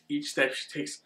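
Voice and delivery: ordinary delivery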